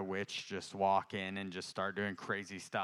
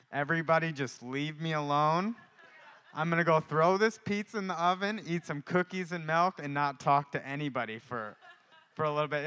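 mildly fed-up, raised voice; retold exasperation; 6 semitones higher, 7 dB louder than usual. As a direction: act out wanting to be left alone. Retold weary plea in checkout line.

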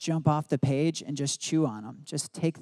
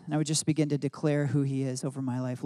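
ordinary talk, nothing marked

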